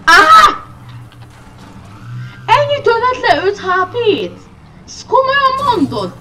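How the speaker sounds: very high, loud disbelieving yell; outraged shout. Real, not acted. Player losing health screams in disbelief. Hit hard in the game.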